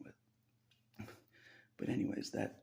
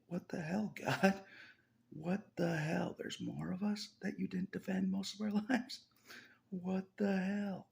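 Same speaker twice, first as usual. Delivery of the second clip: higher, louder, drawn-out what the hell; mock angry outburst; 11 semitones higher, 4 dB louder than usual. Speaker retelling his complaint to God, a higher, louder mock outburst. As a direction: rail at God, half laughing.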